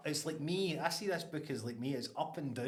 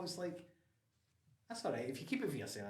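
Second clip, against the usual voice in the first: ordinary talk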